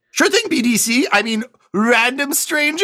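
a squeaky-high NPC clerk, slowing down; booking agent voice. Acted. Booking agent greets the player.

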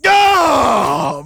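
a sudden pained yell, high and loud; anguished shout. Acted. Husband hearing of his wife's kisses, pained yell. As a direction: cry out as if struck.

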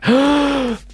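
big gulp of breath before the list; sharp gasp. Real, not acted.

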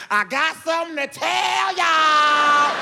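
high, loud, slow proclamation; brother's grand dinner-table reveal; dramatic announcement. Retold or imitated. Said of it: "He was like".

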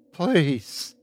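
high, falling plea; pleading. Acted. Wife begs the creature to stop talking, pleading. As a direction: beg it to stop.

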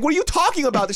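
loud, high mock-panicked denial; hammed-up indignant shriek; freaking out. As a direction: squeal it as if falsely accused. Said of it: "freaking the fuck out".

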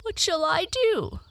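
high, helpless character voice; distressed Tearful. Acted. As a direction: plead for help in a high voice. Stranded Tearful asks for help.

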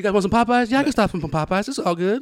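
high-pitched easygoing driver impression; obliging driver. Driver offering passengers a Popeyes stop, high obliging impression.

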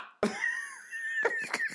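squealing laugh, nearly crying; helpless high laughter. Real, not acted. Teacher retelling the taunt, shrieking with laughter.

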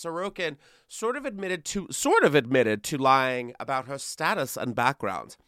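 ordinary talk

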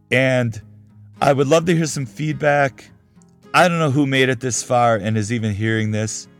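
normal talking voice

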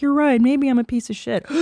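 fake-sob the self-blame; mock crying girl. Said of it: "then the girl's like".

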